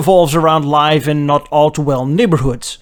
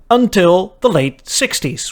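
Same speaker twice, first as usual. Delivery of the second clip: ordinary talk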